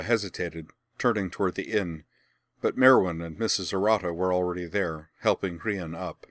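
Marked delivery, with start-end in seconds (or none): none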